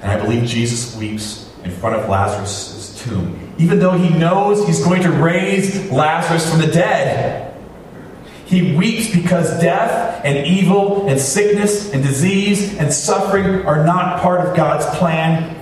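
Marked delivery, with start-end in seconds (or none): 3.6-15.6 high-pitched, emphatic sermon delivery; impassioned preaching. Real, not acted. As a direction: preach it with mounting conviction, weighting each phrase. Preaches at full force.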